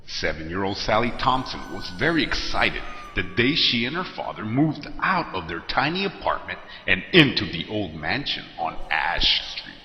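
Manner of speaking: ordinary delivery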